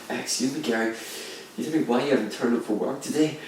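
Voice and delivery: soft, prissy mock boss; mock a self-important manager, quietly; mimicking the manager. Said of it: "You gave him a [name] accent".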